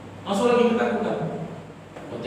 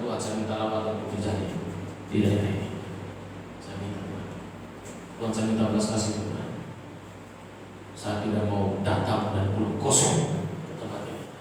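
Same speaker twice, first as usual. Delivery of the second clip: much softer, pleading request; soft pleading prayer, much softer; hushed prayer; 4 semitones lower, 8 dB quieter than usual. Retold or imitated. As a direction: quietly beg God for mercy.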